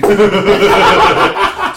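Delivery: loud burst of laughter; big hearty laugh out loud. Real, not acted. Laughs at cranberry turkey joke.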